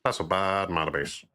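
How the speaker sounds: lightly voiced nerd sneer. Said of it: "the, the nerd voice".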